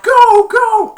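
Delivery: very high, loud cheering scream; cheering yell. Retold or imitated. Crowd cheering Ted to the end zone, very high cheering scream. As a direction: scream them on.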